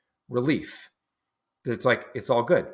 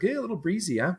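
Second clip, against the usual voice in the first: a high, casual quip about the wind; laid-back local; 9 semitones higher, 3 dB quieter than usual. Retold or imitated. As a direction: toss it off like a local who shrugs off the cold. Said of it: "And the guy's like".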